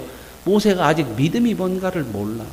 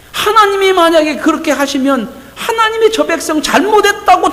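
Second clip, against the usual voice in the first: ordinary talk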